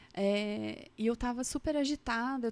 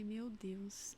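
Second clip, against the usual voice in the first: breathless whisper, much softer; 2 semitones lower, 10 dB quieter than usual. Retold or imitated. Mother struck by her daughter's words, whispering. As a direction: whisper it in dismay to herself.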